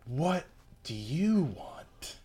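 slow, hushed British vampire purr; sophisticated vampire voice. Acted. Co-host tries Dio's voice for his partner, slow and hushed. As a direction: draw it out like a bored, menacing vampire lord. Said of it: "so it's gotta be like".

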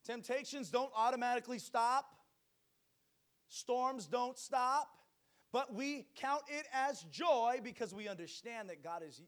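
loud, high-pitched fiery preaching; booming motivational speech. Acted. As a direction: preach it out to the whole church. Preaching at full force.

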